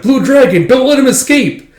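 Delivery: high, loud urgent call to an ally; Zeno shouting to the Blue Dragon to stop the assassin. Retold or imitated. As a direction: yell it across the fight. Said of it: "Zeno's like".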